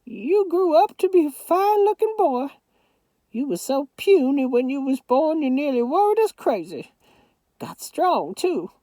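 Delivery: put-on high old Southern grandmother voice; Southern grandma. A narrator's reading. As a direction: scold lovingly as a granny.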